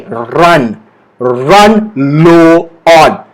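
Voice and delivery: very slow, each word held apart; slow model phrase. Shown as a demonstration. Teacher leads learners' repetition, very slow careful words. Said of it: "So say it with me".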